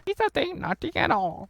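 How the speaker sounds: very high put-on voice; cartoon character. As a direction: say it high and silly, as a parody character. Acted character reply in parody.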